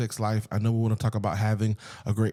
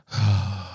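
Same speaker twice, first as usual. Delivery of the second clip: dramatic sigh; 3 semitones lower than usual. Shown as a demonstration. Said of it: "that big sigh".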